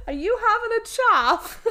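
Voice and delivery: high, loud put-on English accent, laughing; hammed-up English accent through giggles; mock British accent. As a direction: play a chirpy Brit poking fun.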